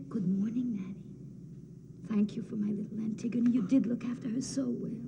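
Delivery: softly whispered thanks from a dead mother. Retold or imitated. Antigone voicing her mother thanking the nurse, whispered. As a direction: hush it like a gentle ghost. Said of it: "And she'll say".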